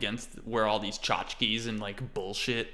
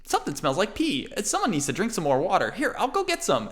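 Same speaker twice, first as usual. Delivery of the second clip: put-on very high, bouncy little boy voice; kid Aiden; 9 semitones higher, faster than usual. Aiden the kid chirping happily to his pops and the party. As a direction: chatter like an excitable little kid.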